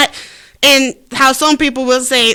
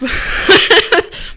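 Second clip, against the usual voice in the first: breathy laugh; 7 semitones higher than usual. Real, not acted.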